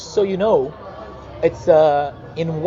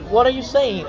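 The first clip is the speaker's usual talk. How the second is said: higher-pitched mock confusion; retold bafflement; 7 semitones higher than usual. Artist replaying what he asked his friends, baffled. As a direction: act out not understanding your friends.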